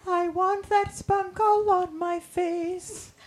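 high joking voice. Pretend.

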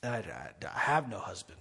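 very low, hushed stammer; falter, then admit it softly under the breath; quiet, halting confession in a dropped pitch; hesitant admission. Acted. Woman admits it when confronted.

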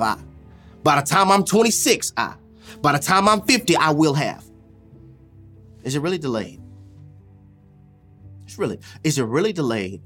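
usual speaking voice

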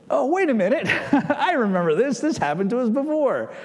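high-pitched, acted sudden recognition; dawning realization. John realizing this happened before, excited dawning realization. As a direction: play a light-bulb moment, voice lifted.

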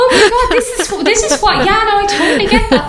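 high, laughing exclamation. Real, not acted. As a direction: laugh through the words. Laughing agreement.

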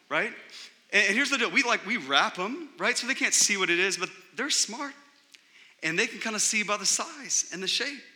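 ordinary talk, nothing marked

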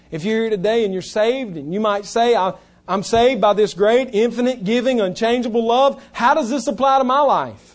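loud, high, driving sermon voice; full-force preaching. Acted. Preacher to the congregation, loud and driving.

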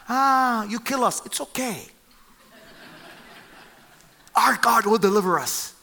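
a lightly voiced breezy shrug of a reply; melodramatic calm. Three men answering the king's threat, lightly melodramatic. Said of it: "their response to him is so melodramatic".

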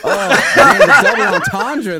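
breathy laughing, slow. Real, not acted. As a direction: laugh the words out. Laughing admiration.

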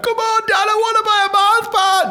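put-on very high pleading kid voice; whining child. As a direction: beg Dad like a whining child. Child begging parent for chocolate.

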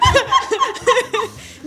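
loud helpless high laugh; high shrieking laugh. Real, not acted. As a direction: burst into shrill laughter. Laughing at mistake.

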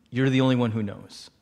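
low, sincere confession; earnest confiding. Retold or imitated. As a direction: imitate a friend entrusting a secret. Friend confides a secret earnestly.

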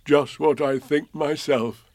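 a touch of a slow teddy bear voice; gentle bear asking a friend; mild Pooh voice; lightly voiced Pooh bear.

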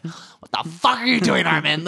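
loud, high pretend phone tirade; mock angry yell. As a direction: open a call by yelling, in jest. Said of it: "it's like me calling you Armin".

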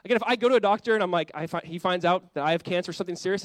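ordinary delivery

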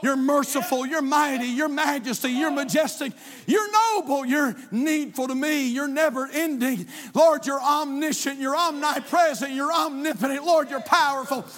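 building preached litany. Acted. Pastor shouting God's names to the church. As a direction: roll the list out like a rising shout.

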